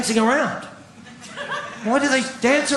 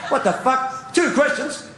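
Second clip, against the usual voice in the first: normal talking voice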